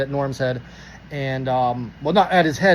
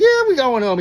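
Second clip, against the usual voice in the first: high, louder retort; exasperated reply; 8 semitones higher, 5 dB louder than usual. Retold or imitated. Driver answers cop asking about going home, exasperated. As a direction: act out an exasperated yes, pitched way up.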